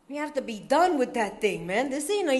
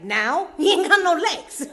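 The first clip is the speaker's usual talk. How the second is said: mock disbelief; 5 semitones higher, 5 dB louder than usual. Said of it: "And I was like".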